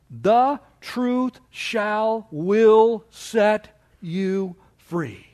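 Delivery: very slow, word by word; solemnly spaced-out scripture quote; emphatic verse reading. Real, not acted. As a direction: pause after each word of the verse. Reads the key verse out emphatically.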